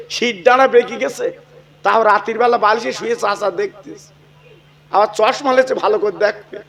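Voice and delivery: normal talking voice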